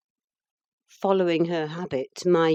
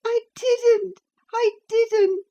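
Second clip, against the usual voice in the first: high, loud sobbing denial; 5 dB louder, slower than usual. A narrator's reading. Cathy sobs her denial to Ellen. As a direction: sob it, fit to break her heart. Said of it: "sobbed Cathy".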